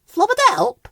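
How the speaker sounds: very high gibberish question; asking. A narrator's reading. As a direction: ask it high and eager. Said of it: "asked Ben".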